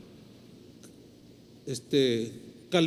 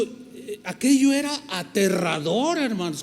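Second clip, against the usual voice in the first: ordinary talk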